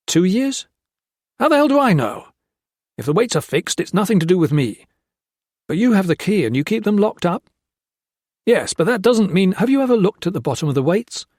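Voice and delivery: high, loud, defensive exchange; heated. A narrator's reading. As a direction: fire back defensively. Tense interrogation.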